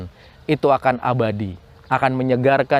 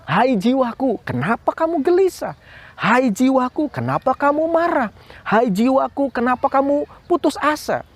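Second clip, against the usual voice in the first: much higher, searching questions to your soul; pleading to the soul; 10 semitones higher than usual. Acted. Preacher showing kids how to question their soul, high pleading. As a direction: call out to your own heart, rising plea. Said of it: "Kamu bilang pada jiwamu".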